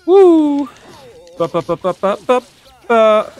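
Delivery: high loud woo into building ba ba ba; gleeful hype noises; excited whoop. Real, not acted. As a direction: cheer as the combo lands.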